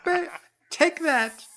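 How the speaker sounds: very high mock-fighting falsetto; dainty high-voiced attacker landing blows; Mary Steenburgen. Playing the woman beating up Steven, high hammed-up voice. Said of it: "I have a Steenburgen".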